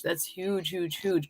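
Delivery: soft fading "huge, huge"; quiet echo. Real, not acted. Host echoing her praise of a comment, soft and fading. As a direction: let the word fall away to almost nothing.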